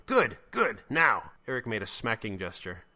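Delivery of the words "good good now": loud, high rapid urging; urgent egging on. Acted. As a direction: goad someone to act right now. Eric urges the slap.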